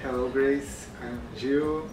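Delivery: ordinary delivery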